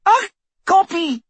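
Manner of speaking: high, loud, incredulous outburst; screech. A narrator's reading. Torturer scorning her captive's claim, shrieking screech. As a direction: screech it in furious disbelief. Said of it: "screeched Bellatrix".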